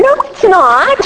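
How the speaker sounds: high, bratty denial; bickering with sponsor in a high, childish voice; childish protest. Pretend. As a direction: argue back like a stubborn kid. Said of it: "that's how I talk to her".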